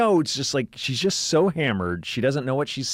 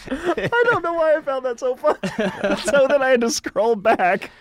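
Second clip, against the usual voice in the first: high-pitched, giggling through the words; can't stop laughing while telling it; 10 semitones higher, 3 dB louder than usual. Real, not acted.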